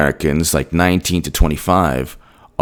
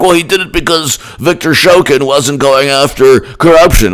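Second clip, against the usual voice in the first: higher-pitched mocking imitation of defenders; mocking excuse; 7 semitones higher than usual. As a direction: parrot their excuse in a raised, mocking voice.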